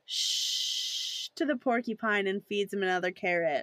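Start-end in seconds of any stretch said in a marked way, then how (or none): 0.1-1.3 long, drawn-out shush; sustained acted "shh". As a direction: hush a pet. Neville hushing his porcupine.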